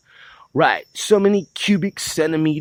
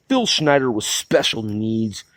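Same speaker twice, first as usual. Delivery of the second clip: normal talking voice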